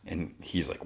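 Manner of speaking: ordinary talk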